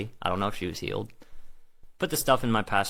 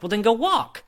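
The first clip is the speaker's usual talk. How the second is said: high and loud, bursting with excitement; excited urging; 7 dB louder than usual. Retold or imitated. Telling the healed woman to go walk, high and loud with excitement. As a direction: push the words up high and loud, thrilled.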